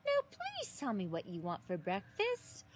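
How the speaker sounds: ordinary delivery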